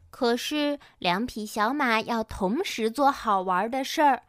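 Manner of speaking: plain reading voice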